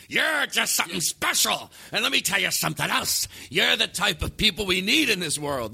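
sweet words in a hostile tone; angry attitude. Acted. Giving someone kind words with that attitude, mock-angry. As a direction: deliver compliments as if telling someone off. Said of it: "sweet, kind things with that attitude".

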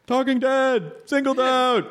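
imitating fans calling out, high-pitched; high, excited mimicry of strangers shouting recognition; mock fan calls.

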